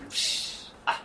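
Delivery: ordinary talk, nothing marked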